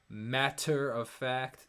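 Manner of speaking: MATTER OF FACT slowly, with a hard T in MATTER; crisp consonants. Shown as a demonstration. Singer showing viewers big consonants, crisp slow MATTER OF FACT. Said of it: "all these big sibilants".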